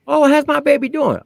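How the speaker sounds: high, sweet mama voice; mock doting mother. Drill sergeant imitating the private's mother, high and sweet. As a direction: gush like a proud mother.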